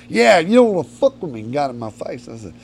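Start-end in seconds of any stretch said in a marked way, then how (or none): 0.1-1.4 threatening bouncer. Retold or imitated. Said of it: "he goes".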